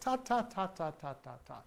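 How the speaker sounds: soft ta ta ta, fading away; quiet unvoiced baby babble. Shown as a demonstration. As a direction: babble the dental stop without voice.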